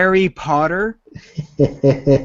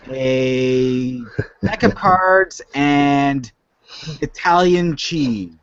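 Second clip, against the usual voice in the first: usual speaking voice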